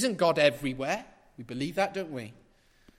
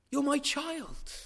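slow, gentle reassurance; tender voice of God; 9 semitones higher than usual. Acted. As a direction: say it like a father to his child. Voicing God's tender words.

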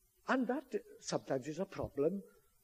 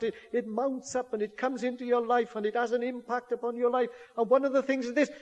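raised, higher, louder pulpit voice; preaching at full force; 6 semitones higher, 6 dB louder than usual. Acted. Preacher urging troubled hearers to come to church, full force.

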